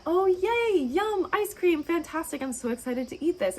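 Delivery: high, gushing delight; excited. Pretend. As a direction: squeal with joy over the ice cream. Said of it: "in that peaceful headspace".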